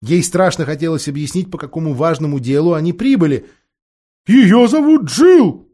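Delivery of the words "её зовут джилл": high, loud, slow shout of Джилл; shouting to the deaf dwarf, high and slow; loud owl shout. A narrator's reading. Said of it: "громко крикнула сова".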